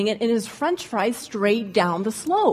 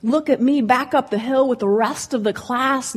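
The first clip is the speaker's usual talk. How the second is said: usual speaking voice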